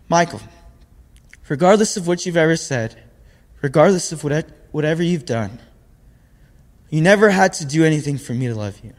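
tender mom. Retold or imitated. Mom forgiving her son on phone.

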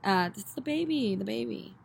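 ordinary delivery

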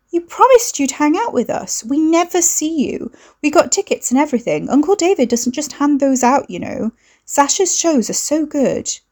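high, bubbly and wheedling; pleading sister. A narrator's reading. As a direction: push the voice up, begging him to come. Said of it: "Lucy's voice went up a fraction".

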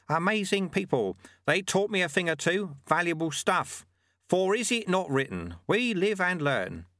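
high-pitched sage sharing his learning; Lutze, musing monk. Acted. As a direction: reminisce approvingly, then cite a maxim.

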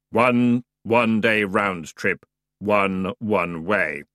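slow, dry, weary boatman; bored underworld ferryman; grim ferryman voice. Acted.

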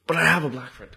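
lightly mocking, slightly put-on protest; mocked excuse-maker. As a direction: parody someone making a lame excuse. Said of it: "when you see people drop that shit".

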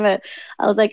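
normal talking voice

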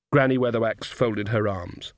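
plain reading voice

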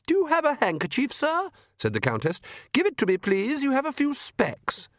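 dab at him like a doting wife; fussing countess. Acted.

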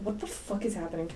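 soft, low retold disbelief; muttered bewilderment. Teacher after the girl walks away, quietly baffled. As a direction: keep it down, as if to yourself.

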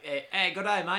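high, loud mock-Aussie greeting; bad Australian accent. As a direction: hail a mate in hammed-up Australian. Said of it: "I can't do an Australian accent".

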